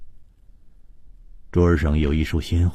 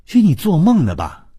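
shocked, much higher and louder; stunned; 13 semitones higher, 8 dB louder than usual. A narrator's reading. Nurse stunned by the patient's ghost story, loud and high. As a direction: burst out in disbelief. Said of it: "谭小颖惊愕地说".

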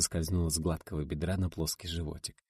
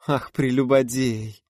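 mock outrage; 7 semitones higher, 8 dB louder, slower than usual.

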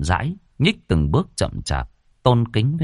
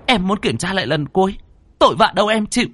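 high, heated insistence; defiant demand; 11 semitones higher, faster than usual. A narrator's reading. Aunt Lan insists on one last check, heated defiance. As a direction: push past an objection, pitched right up. Defiant quarrel over opening the coffin.

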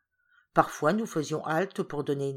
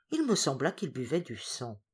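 usual speaking voice

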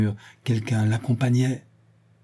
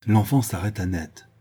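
plain reading voice

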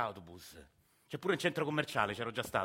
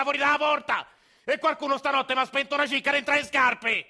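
much higher, much louder furious yell; angry shout; 14 semitones higher, 10 dB louder than usual. Acted. Grandfather yelling at dreaming Agostino, loud furious shout. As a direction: bark him awake, fed up and furious. Old man ranting at grandson.

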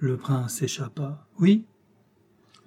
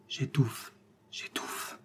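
unvoiced, stifled gasp of words; breath failing, fading to almost nothing; choked whisper; 3 semitones lower, 10 dB quieter, much slower than usual. A narrator's reading. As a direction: whisper as if suffocating. General suffocating under his remorse.

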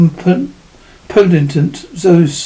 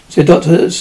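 ordinary delivery, nothing marked

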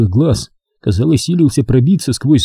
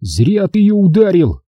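mild warning, pitched up; understated menace in a higher pitch; threatening; 8 semitones higher than usual. A narrator's reading. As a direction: warn him off firmly. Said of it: "с угрозой заметил Торок".

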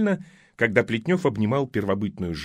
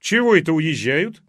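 surprised; 4 semitones higher, 5 dB louder than usual. Acted. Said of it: "изумилась соседка".

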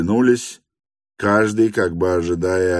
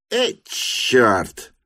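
higher, drawn-out muttering of annoyance; annoyed curse; 6 semitones higher than usual. A narrator's reading. Lyamshin gives in and curses, higher exasperated voice. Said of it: "выругался Лямшин".

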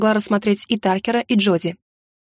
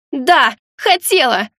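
loud slow shout, higher; 4 semitones higher, 4 dB louder, much slower than usual. A narrator's reading. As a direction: yell it out, dragging the words. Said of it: "закричала она".

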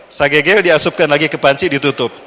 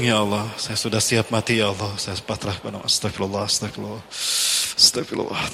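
much softer, lower, fading into a whisper; faint weak prayer; 7 semitones lower, 7 dB quieter than usual. Acted. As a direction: pray faintly, too weak to speak up. Weak hungry man praying. (doa lirih dan lemah, hampir berbisik)

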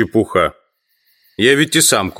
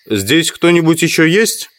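raised, questioning call into the house; 9 semitones higher, 3 dB louder than usual. Acted. Man calls into the dim house, raised questioning call. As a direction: call out, higher and louder, wondering if anyone's there.

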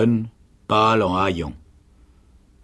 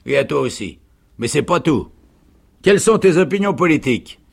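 loud, raised sergeant's voice; interrogating sergeant; 7 semitones higher, 6 dB louder, faster than usual. A narrator's reading. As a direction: build to a high, quick demand. Sergeant turns to interrogating the woman. (sergent qui interroge, de plus en plus fort)

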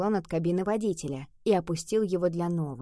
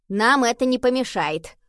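slightly higher, louder comic disguise; funny voice; 4 semitones higher, 6 dB louder than usual. A narrator's reading. Henry answers the taxi driver in his funny voice. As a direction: answer in a lightly put-on funny voice. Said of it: "Генри ответил своим забавным голосом".

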